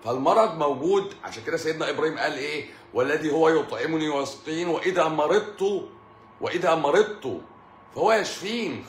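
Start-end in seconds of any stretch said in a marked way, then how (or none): 2.9-8.8 raised, slowed Quranic recitation. Acted. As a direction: recite it loud and high.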